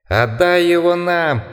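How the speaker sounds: high, slow howling call; laughing howling voice. A narrator's reading. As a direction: howl it like a mocking beast. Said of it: "крикнул ещё один голос, смеющийся и подвывающий".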